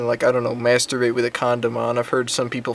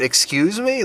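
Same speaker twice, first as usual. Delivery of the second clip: indignant, high rising question; rudely taken aback, pitched up; 9 semitones higher, slower than usual. Retold or imitated. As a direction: act out a rude, offended comeback. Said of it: "Like, really rudely".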